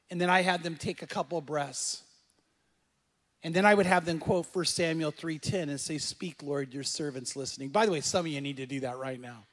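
hushed, low prayerful voice; soft, low murmured prayer; guided prayer. Shown as a demonstration. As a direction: lead them softly into prayer, keeping it low. Leads a quiet prayer moment.

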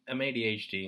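normal talking voice

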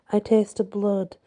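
usual speaking voice

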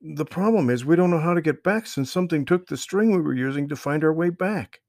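put-on worried boy explaining they're lost; young boy voice. A narrator's reading. Brother explains to Marvin they lost the string, high boy voice. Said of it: "Trey said".

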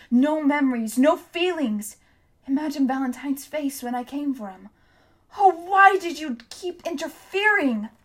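high, loud anguished rant; raging grief. A narrator's reading. Hester rails at Tom over Shrike, high anguished rant. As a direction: pour out the bitter grief.

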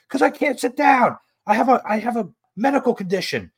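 high-pitched exasperated protest; mock outraged whine; acted indignant defence. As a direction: play the aggrieved sleeper protesting. Dream man's acted angry defence.

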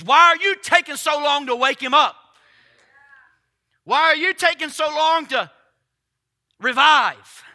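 shouted high, loud question; booming challenge. Acted. Preacher voicing God's question to the church, loud and high. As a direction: hurl the question at the congregation.

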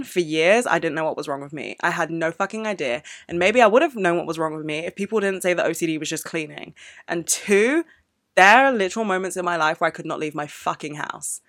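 ordinary talk, nothing marked

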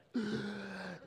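sudden high gasp; acted reviving gasp; imitated gasp for air. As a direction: gasp like coming back to life. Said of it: "he gasps for air".

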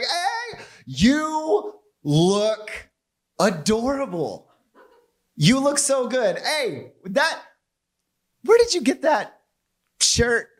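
high, drawn-out gushing compliment; gushing woman friend. Retold or imitated. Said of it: "it'll be something like".

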